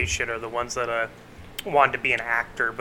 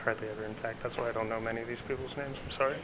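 softer, confessional aside into the mic; hushed, apologetic murmur; whispering; 2 semitones lower, 10 dB quieter than usual. Real, not acted. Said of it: "I'm just whispering into the mic".

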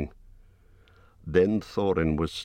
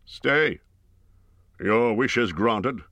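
higher, slow, firm command; Gandalf commanding; 5 semitones higher, slower than usual. A narrator's reading. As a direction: stop them with wizardly authority. Gandalf intervenes.

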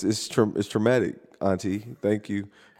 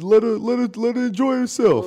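cousin voice; 12 semitones higher, 3 dB louder than usual. Retold or imitated.

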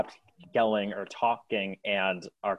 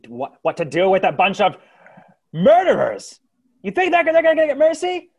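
high, loud angry yelling; furious crowd shout; 12 dB louder, faster than usual. Acted. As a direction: rile up the crowd.

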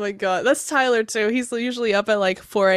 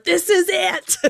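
excited; 10 semitones higher, 3 dB louder, slower than usual. Retold or imitated. Quoted own excited thought.

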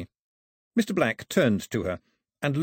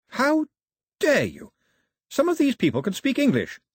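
high-pitched, louder outrage; indignant rebuke; 4 dB louder than usual. Acted. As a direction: burst out in offended protest. Girl indignantly rebukes her grandmother.

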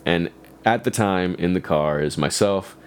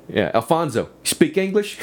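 mock Southern cop; 10 semitones higher than usual. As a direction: ask it like a smug Southern cop. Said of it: "really thick Southern accent".

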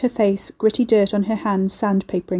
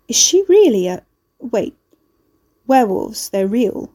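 stunned disbelief; 4 dB louder than usual. A narrator's reading. Carrie stunned by werewolf news.

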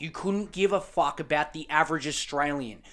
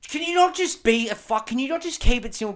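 exasperated rant; 7 semitones higher, 4 dB louder than usual. Real, not acted. Ranting at the journalist.

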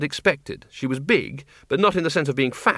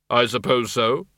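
grave, measured figure of Death; Death's voice. Acted. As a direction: speak as Death, calm and unhurried. Death's voice in story.

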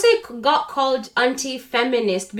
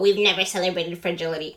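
mocking quote; 4 semitones lower, much faster than usual.

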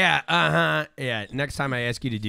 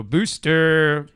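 announcer voice; 3 semitones higher, 5 dB louder than usual. Acted. Announcing producer's name.

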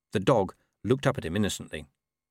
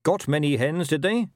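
lightly voiced rider, pitched up; rider's question; 6 semitones higher, 4 dB louder than usual. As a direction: inquire as the horseman.

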